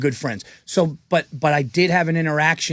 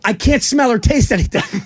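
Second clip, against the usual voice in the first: panicked insistence; 7 semitones higher than usual. Retold or imitated. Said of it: "I go".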